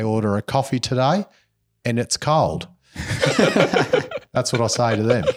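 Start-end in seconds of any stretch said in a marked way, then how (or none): 2.9-4.3 airy wheezing laugh; breathy laugh. Real, not acted. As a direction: laugh under your breath at your own joke. Laughing at his own joke.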